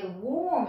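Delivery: ウォーム with a long "or" vowel after the W; correct warm. Shown as a demonstration. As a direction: say ウォーム with a rounded "oh", not "ah". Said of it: "オーっていう音に変わるんですね".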